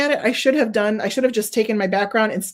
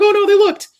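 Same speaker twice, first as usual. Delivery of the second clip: high, loud panicked yelp; playful hammed-up alarm; mock panicked dog; 10 semitones higher, 8 dB louder than usual. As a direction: voice the scared pup as the rabbits look. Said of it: "he's like".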